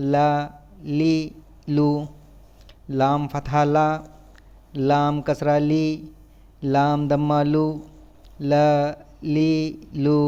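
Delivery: even, monotone syllable-by-syllable drill; measured classroom letter drill; spelling drill recitation. Shown as a demonstration. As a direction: recite it like a spelling chant, pausing between items.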